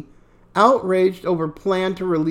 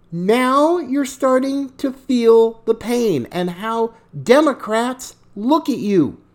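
ordinary talk, nothing marked

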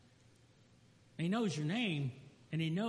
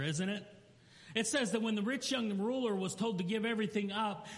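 ordinary delivery

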